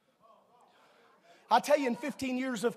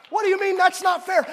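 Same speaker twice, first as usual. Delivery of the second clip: high, loud mock protest of a kid; whiny child; 7 semitones higher, 9 dB louder than usual. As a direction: play the complaining kid.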